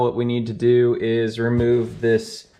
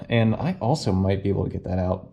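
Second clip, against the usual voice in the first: ordinary delivery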